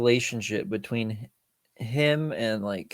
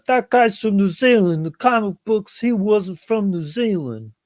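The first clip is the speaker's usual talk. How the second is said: higher, louder whiny complainer voice; mock fanboy; 9 semitones higher, 6 dB louder than usual. Friend mocking comic fans who complain, higher louder whiny voice. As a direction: imitate a nitpicking comic fan. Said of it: "That one guy".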